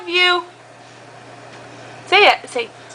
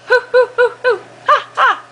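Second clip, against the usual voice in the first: monkey sounds; 9 semitones higher, much faster than usual. Shown as a demonstration. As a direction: hoot like a monkey to make him laugh. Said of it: "What does a monkey say?".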